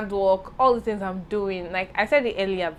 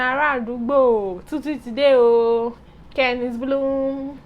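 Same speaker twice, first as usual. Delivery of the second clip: mock hype; 5 semitones higher, 6 dB louder, slower than usual. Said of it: "Tell them".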